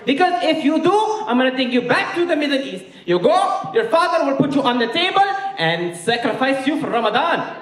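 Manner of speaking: put-on very high, accented scolding; outraged Egyptian mom. Mom scolding son about the girlfriend, high outraged voice. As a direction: mom exploding at her son.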